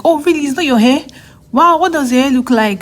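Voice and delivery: high, loud astonished questions; surprised friends. Retold or imitated. Friends at game night astonished the hair is a wig, high and loud. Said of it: "all of them were surprised".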